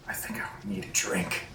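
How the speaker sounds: barely voiced, drained plea; very soft, quick, breathy admission; weak whisper. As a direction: whisper it as if shaken and spent. Shaken character weakly asks for drink.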